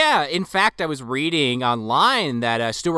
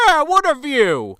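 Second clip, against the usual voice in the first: high, slow, contented sigh; mock blissful host; relaxed Peter Weller; 11 semitones higher, 5 dB louder than usual. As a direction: savor it like a hot tub soak.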